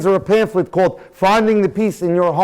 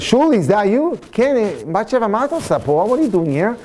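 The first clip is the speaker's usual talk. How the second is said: higher, delighted surprise at meeting; surprised recognition; 6 semitones higher, faster than usual. Retold or imitated. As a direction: call out to an old friend you spotted. Old acquaintance recognizes her abroad.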